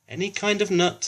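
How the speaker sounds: put-on high, eager little boy; eager child. A narrator's reading. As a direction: chirp the answer back. Finley answers teacher.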